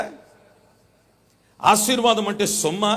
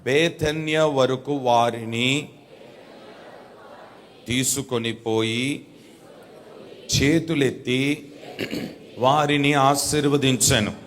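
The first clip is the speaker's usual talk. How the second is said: slow dictation reading; 6 semitones lower, slower than usual. Shown as a demonstration. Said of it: "ఇంకొంచెం మీ volume పెంచండి".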